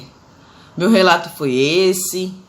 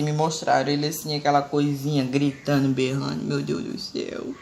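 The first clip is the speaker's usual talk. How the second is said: ordinary talk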